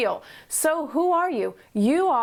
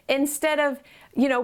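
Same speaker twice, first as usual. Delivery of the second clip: ordinary delivery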